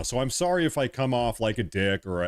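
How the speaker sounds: ordinary talk, nothing marked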